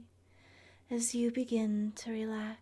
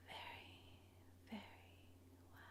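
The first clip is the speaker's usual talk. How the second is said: whispered approval; 24 dB quieter, faster than usual. Acted. Godmother to listener ready to begin the journey, whispered approval.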